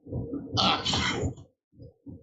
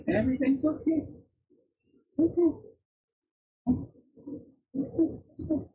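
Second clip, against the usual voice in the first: soothing cat talk; 12 semitones higher than usual. Real, not acted. To her cats squaring off, high soothing coo.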